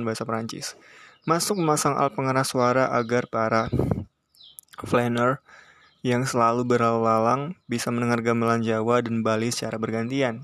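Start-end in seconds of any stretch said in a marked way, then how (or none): none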